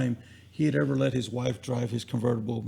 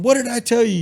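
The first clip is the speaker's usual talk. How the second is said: much higher and louder, an angry demand; angry boss; 9 semitones higher, 5 dB louder than usual. Retold or imitated. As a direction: bark it at him like a furious boss. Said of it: "He's still mad at me".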